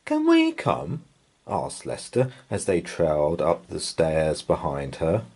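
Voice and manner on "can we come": put-on high eager little child; child's voice. A narrator's reading. Lester asking to come along, high child voice. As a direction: squeak it up like a small boy. Child pleads to follow mum.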